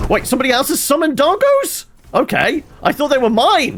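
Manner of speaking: squeaky high, loud surprise; startled that the dogs aren't his; surprised. Real, not acted. Streamer surprised his summoned dogs aren't his, high-pitched.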